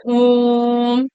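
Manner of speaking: ओम stretched slowly to three beats; prolonged pluta vowel. Shown as a demonstration. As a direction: draw the ओम out long for the class. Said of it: "time लग रहा है कि नहीं लग रहा है". (ओम को धीरे-धीरे तीन मात्रा तक खींचें)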